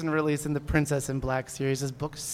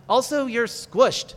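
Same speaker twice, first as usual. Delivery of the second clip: higher, slow and loud; a slow, raised princess line; character voice; 7 semitones higher, 5 dB louder, slower than usual. Acted. Princess in blankets speaks.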